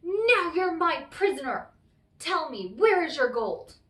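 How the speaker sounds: loud, high triumphant demand; triumphant cry. Acted. Tom grabs the leprechaun and demands his gold, loud cry. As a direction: seize him and shout for the gold. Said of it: "grabbed the sly fellow and cried".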